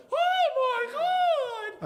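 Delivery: hugely drawn-out, high, loud cry; exaggerated stage acting. Shown as a demonstration. Mocking theater actors on screen, wildly exaggerated. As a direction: project it to the back row, hammed-up. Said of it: "you have to exaggerate".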